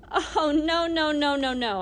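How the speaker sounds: loud, hurried protest with a cry in it; frantic denial. Acted. Boy panics at the old lady's question, loud protest. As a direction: deny it in a panic.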